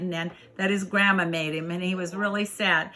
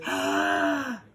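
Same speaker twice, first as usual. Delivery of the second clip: big gasp, then achoo; bear's sneeze; 12 semitones lower than usual. Acted. Bear sneezing inside the mitten, gasp and achoo. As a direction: mimic a sneeze with a sharp intake. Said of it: "the bear sneezed".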